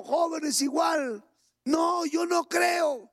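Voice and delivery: full-force preacher's cry; fiery preaching. Acted. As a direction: drive it home to the congregation.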